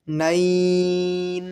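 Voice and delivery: "নাইন" with a long drawn-out glide into the final ন; madd lin elongation. Shown as a demonstration. Teacher showing learners one alif madd lin, "নাইন" stretched out. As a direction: stretch "নাইন" slowly on a held, level note. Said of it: "এক আলিফ মাদ্দে লিন হবে".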